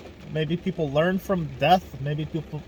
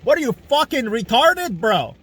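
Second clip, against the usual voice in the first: furious shouting; 7 semitones higher, 4 dB louder than usual. Real, not acted. Yelling at Putin's fans about Stalin and Hitler, in a furious high shout. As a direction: bellow it at them in disbelief.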